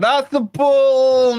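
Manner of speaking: high, loud, drawn-out cry; excited call. Real, not acted. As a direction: stretch it out in a thrilled shout. Fan reacts to a good pass.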